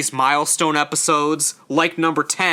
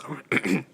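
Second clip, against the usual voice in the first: quiet, rough throat clear; 7 dB quieter than usual. Real, not acted. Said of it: "I'm choking".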